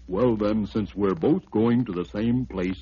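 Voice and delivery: low, fading elephant voice. Acted. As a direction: let the elephant trail off low.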